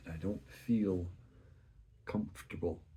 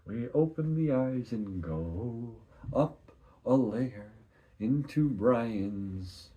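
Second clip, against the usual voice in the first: slow, deliberate telling; 4 semitones higher, 5 dB louder, much slower than usual. Acted. Slowly narrating the merging.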